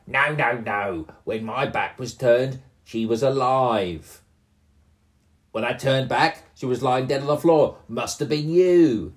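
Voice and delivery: loud defensive goblin. Acted. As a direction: protest as a goblin, building up. Second goblin voiced.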